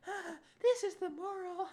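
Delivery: very high squeaky laugh-talk; hammed-up high chortling through the words; clenched mock laughing. Everyone talking in the clincher scene, mock laughing squeal. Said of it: "they're all talking like".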